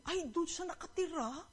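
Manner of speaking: retold gasp of disbelief; surprised. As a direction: react with surprise, voice lifted. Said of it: "nagulat kami".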